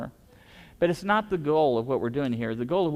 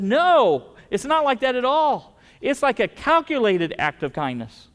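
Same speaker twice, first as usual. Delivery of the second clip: higher and louder, emphatic; eager correction; 8 semitones higher, 6 dB louder than usual. Retold or imitated. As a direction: set her straight with energy. Pastor corrects the lady, retold.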